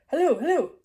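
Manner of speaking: loud, high thick-voiced calling. Dreamed vet on the phone, thick voice. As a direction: call out in a thick corny German voice. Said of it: "the thick voice saying".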